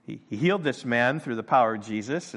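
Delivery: normal talking voice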